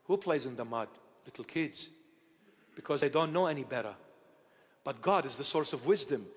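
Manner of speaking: ordinary talk, nothing marked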